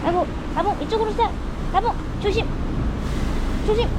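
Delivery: high sing-song call to the dog. Real, not acted. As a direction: coax the dog over, high-pitched. Calling the dog over.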